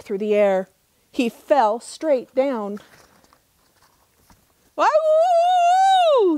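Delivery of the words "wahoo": very high loud howling whoop. Acted. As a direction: howl it out as he falls. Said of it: "he howled".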